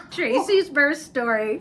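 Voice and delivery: slow, grand title announcement; slow dramatic title read; announcer voice. Acted. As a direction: announce it like a chapter heading.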